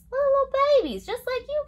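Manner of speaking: put-on high cooing baby talk; sing-song baby voice. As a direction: coo it like talking to an infant. Cooing over babies in book.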